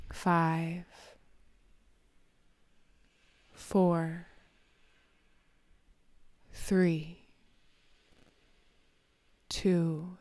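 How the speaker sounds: calm numbers with long pauses; slow soothing countdown. Acted. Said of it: "as I count backwards from ten to one".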